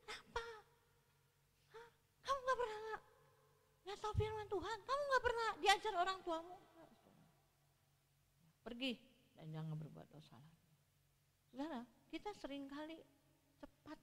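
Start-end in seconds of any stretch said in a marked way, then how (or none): 0.1-6.6 high, reproachful nagging voice; mock a judgmental scolder, pitched high; scolding accuser. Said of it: "Tuhan nggak bilang". 8.6-10.7 gentle forgiveness. Acted. Jesus' gentle words repeated.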